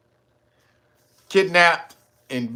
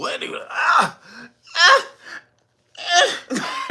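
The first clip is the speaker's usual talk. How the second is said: 1 mock pained wail; 13 semitones higher than usual. Mimicking mayor's pain.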